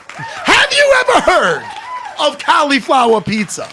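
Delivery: high, drawn-out comic scream. Retold or imitated. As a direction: scream it as if astounded, stretched out. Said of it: "Please stop screaming at me".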